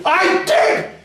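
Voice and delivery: high, exasperated shout; sudden loud outburst; yelling. Acted. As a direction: scream it back in protest. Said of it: "you didn't have to yell".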